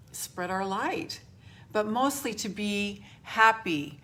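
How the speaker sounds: normal talking voice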